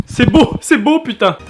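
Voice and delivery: loud high delight; excited shout. Real, not acted. Frying sound, delight.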